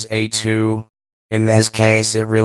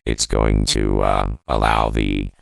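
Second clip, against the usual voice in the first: robotic vocoder voice dropped deep; deep pitch shifted. Shown as a demonstration. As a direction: voice shifted far lower by effect. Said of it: "Minus fourteen semitones".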